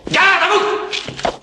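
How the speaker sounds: slow, high, loud barked order; military command. Acted. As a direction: bark the command at a soldier. Officer commands attention.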